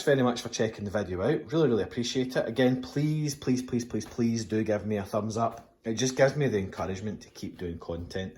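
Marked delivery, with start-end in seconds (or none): none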